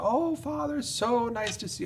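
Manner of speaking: lightly put-on Southern accent, high and sweet. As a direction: imitate a friendly Southern bus driver, badly. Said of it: "with a beautiful Southern accent".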